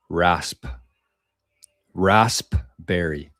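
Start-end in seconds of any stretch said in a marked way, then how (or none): 1.9-3.3 "Raspberry" drawn out slowly, sounding every letter; testing how "Raspberry" sounds; slow spelled-out raspberry. Shown as a demonstration. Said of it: "Is it raspberry or raspberry?".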